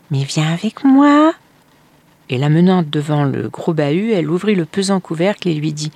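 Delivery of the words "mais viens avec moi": high, slow insistent coaxing; stepmother coaxing. A narrator's reading. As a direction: draw the boy along with false warmth.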